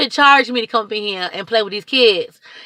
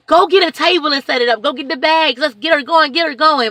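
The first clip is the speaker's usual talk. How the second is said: higher and louder, ordering people to get moving; rally the crew like a foreman; barking cheerful orders at the volunteers; bossy call; 7 semitones higher, 5 dB louder than usual. Retold or imitated. Urging idle helpers to work.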